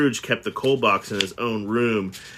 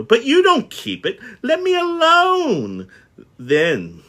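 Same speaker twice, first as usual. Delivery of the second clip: heated exchange; 7 semitones higher, 5 dB louder, slower than usual. Acted. Nephew vs Scrooge exchange.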